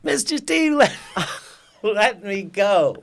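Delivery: imitating a little girl laughing to be freed; squealing kid pretending to escape; playful little girl. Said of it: "Like, playing".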